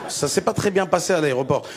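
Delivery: usual speaking voice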